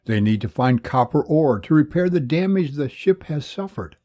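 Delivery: usual speaking voice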